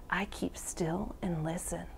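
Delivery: soft, whispered story narration; hushed whisper. A narrator's reading. Reader tells children of keeping still to listen, whispering.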